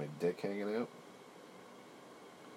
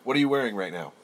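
mock shout; 6 semitones higher, 14 dB louder, faster than usual. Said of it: "imagined friends react".

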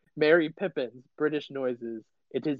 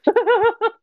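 loud burst of laughter; 11 dB louder than usual. Real, not acted.

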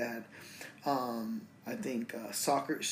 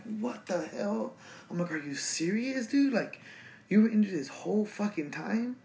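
disbelieving, half-laughing what-the-hell; shocked disbelief; 8 semitones higher than usual. Retold or imitated. Friend pops out of closet.

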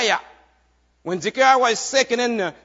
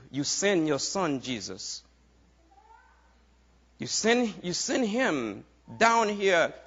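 soft prayer; 5 semitones lower, 5 dB quieter than usual. Acted. Leads a prayer softly.

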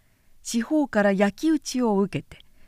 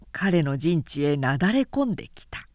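usual speaking voice

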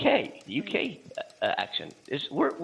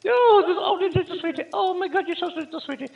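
put-on very high, gushing old-lady voice; Missus Doubtfire; 5 dB louder than usual. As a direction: coo sweetly in a hammed-up falsetto. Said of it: "now I'm sounding like Missus Doubtfire".

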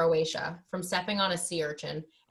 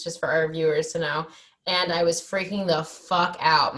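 ordinary delivery

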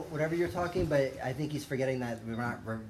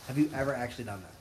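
ordinary talk

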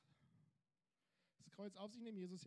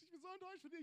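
higher, whining complaint; whining believer; 9 semitones higher, much faster than usual. Retold or imitated. Believer whining to Jesus about being let down. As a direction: pitch the whine up, self-pitying.

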